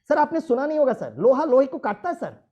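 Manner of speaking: yüksek sesle, yüksek perdeden tumturaklı replik; film repliği gibi. Retold or imitated. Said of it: "एक dialogue बोल दिया".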